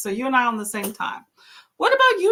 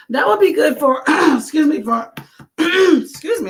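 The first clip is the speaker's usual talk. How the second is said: real throat-clearing between words, louder and higher; choked up; 6 semitones higher, 7 dB louder than usual. Real, not acted. Vlogger interrupting her book comment to clear her throat, choked up. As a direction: break off to clear your throat and excuse yourself. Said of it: "I'm getting all choked up".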